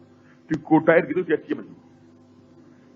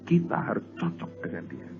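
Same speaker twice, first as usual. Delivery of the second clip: soft earnest emphasis; 7 semitones lower, 8 dB quieter than usual. Real, not acted. Preacher's quiet key point.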